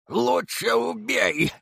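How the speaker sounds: high, slow, strained hoarse cry. A narrator's reading. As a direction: rasp it out in terror, drawn out. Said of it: "прохрипел сторож". (хриплый крик в ужасе)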